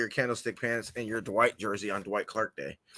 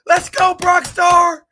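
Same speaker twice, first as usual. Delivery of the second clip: stretched-out stadium yell, much higher and louder; hype cheer; 11 dB louder, much slower than usual. Retold or imitated. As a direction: cheer him onto the field, slow and loud. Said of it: "I'm like".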